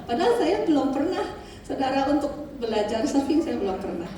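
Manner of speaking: fast, laughing as she restarts the sentence. Real, not acted. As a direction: talk through the giggle and hurry on. Laughing after slip.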